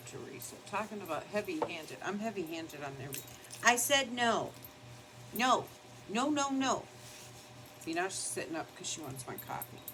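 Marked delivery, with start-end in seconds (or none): 3.6-6.8 high firm no; pushy dog told no, higher; scolding the dog. Real, not acted. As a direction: tell the dog off. Scolding dog wanting coffee.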